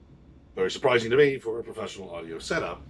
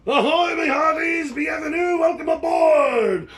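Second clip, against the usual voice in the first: much higher, slow, shouted pirate hail; 4 dB louder, slower than usual. Real, not acted. Pirate captain hailing the audience, shouting. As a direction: call the whole hall aboard.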